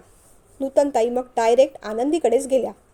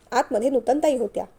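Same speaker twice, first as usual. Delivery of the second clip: ordinary delivery, nothing marked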